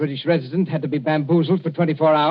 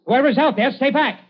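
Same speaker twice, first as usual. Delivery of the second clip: much higher, loud urgent warning; louder call out; 9 semitones higher, 4 dB louder than usual. Acted. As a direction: push the repeat up, almost a yell. Warning repeated, more urgent.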